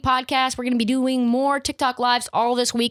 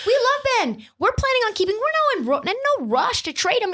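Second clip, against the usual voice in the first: much higher, fake-cheery executive voice; a front-office man pretending to love him, pitched way up; mock Daryl Morey; 11 semitones higher, faster than usual. Podcaster mimicking the Sixers boss, high and mock-sweet. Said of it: "Daryl Morey".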